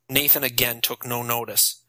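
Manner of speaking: ordinary delivery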